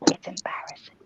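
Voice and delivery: hushed, embarrassed whisper. Real, not acted. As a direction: whisper it so no one hears. Embarrassed aside.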